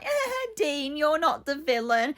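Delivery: high, sugary mock of a gushing friend; pally-pally. Mocking Gina cosying up to Dean, pally-pally. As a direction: mimic her sickly chumminess in a squeaky little voice. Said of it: "She's all pally-pally".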